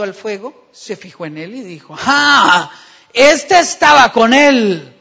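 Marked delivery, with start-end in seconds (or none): 2.0-4.9 loud, slow accusing cry; accusing shout. Acted. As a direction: point at him and shout it out. Servant girl accuses Peter.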